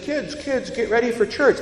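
put-on high-pitched mom calling the kids; harried mother's high call. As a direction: call the children to get ready, pitched well up. Mother calling her kids to church.

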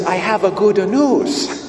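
a put-on Italian accent, drawn out and proud; thick Italian accent. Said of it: "in a thick Italian accent".

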